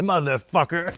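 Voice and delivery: loud furious curse, hammed-up; mock angry curse. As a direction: bark it like an enraged dad. Dad throws tape out window.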